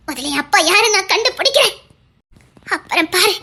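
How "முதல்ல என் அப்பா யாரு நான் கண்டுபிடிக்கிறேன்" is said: ordinary talk, nothing marked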